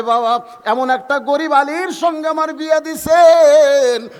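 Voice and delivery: high, drawn-out wailing complaint; loud lament. Acted. Daughter complaining to father of her poor marriage, high lament. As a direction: lament it slowly at full voice.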